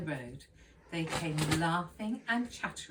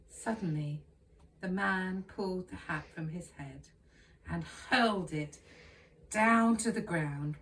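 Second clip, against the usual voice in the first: plain reading voice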